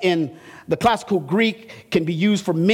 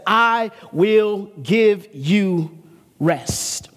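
slow tender promise; much slower than usual. Acted. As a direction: let the promise settle gently. Slow drawn-out promise over music.

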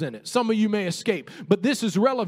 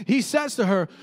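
ordinary talk

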